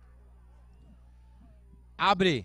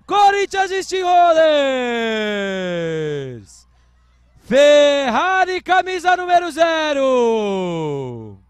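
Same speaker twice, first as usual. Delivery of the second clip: louder, higher, stretched-out goal-style yell; touchdown call; 8 semitones higher, 13 dB louder, slower than usual. Real, not acted. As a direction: roar the touchdown to the crowd. Touchdown run in live play-by-play.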